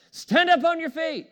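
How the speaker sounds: Paul's command, loud and high. Retold or imitated. Preacher retelling Paul to the crippled man, loud command. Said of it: "he said it with a loud voice".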